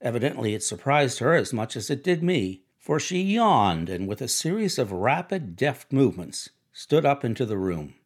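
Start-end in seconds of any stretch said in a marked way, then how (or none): none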